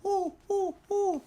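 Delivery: high monkey hoots ending in ah; high hooting monkey finale; monkey call. Acted. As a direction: hoot like a monkey.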